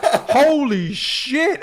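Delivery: high, loud, drawn-out astonished cry; amazed swearing. Retold or imitated. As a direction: stretch it out slowly in re-enacted disbelief. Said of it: "I'm just swearing".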